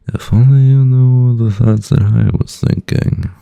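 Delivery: slow, high, sinister villain line; evil villain voice. Pretend. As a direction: gloat like a cartoon villain. Villain bit continues.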